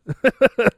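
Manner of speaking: a high, disbelieving burst of laughter; incredulous laugh. Real, not acted. Vlogger laughing in disbelief at being harassed on eBay, high incredulous laugh. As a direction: laugh in disbelief at how absurd it is.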